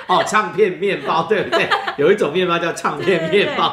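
amused, slow and laughing. Real, not acted. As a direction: play along, still laughing. Playful agreement with child.

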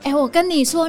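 ordinary talk, nothing marked

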